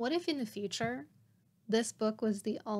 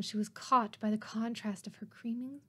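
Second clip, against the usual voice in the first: ordinary delivery, nothing marked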